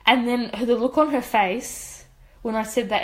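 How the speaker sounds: ordinary talk, nothing marked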